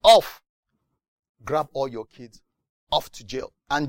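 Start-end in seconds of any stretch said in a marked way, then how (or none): 0.0-0.4 the tax man shouting the order; barked order. Retold or imitated. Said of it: "they look at you and say".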